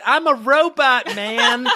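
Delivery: slow high goofy robot voice; drawn-out, hammy robot man. Retold or imitated.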